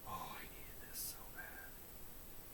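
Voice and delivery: slow relieved whisper. Acted. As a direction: breathe it out softly, slowly. Acting relieved eater.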